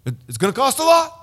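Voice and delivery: lightly voiced threatening cop; stern police captain. Captain warning the gringo at roadside, mock stern. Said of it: "And he's like".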